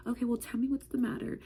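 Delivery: put-on calm a child in the office; gentle principal. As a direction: principal behind a closed door, softly asking. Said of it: "and she's like".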